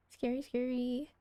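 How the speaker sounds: normal talking voice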